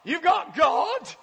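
loud, high, hammed-up comforting; a nervous believer shouting comfort; mock panicked reassurance. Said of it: "They freak out and say".

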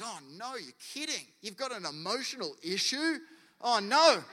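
high-pitched, hammed-up shock; mock dismay. God supposedly freaking out at honesty, mock horror. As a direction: act appalled, pitch climbing.

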